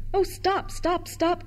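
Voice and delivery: high, eager repeated calls; wild cry. A narrator's reading. Children running toward the ice cream barrow, wild cries. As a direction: call out excitedly in a rush. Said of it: "cried the children wildly".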